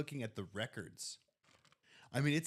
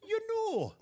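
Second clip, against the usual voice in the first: very high, loud Irish lilt; exaggerated Irish accent swooping down; Kamala gone Irish; 6 dB louder than usual. Co-host showing a politician's slip, mock Irish accent. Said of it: "But then she went Irish".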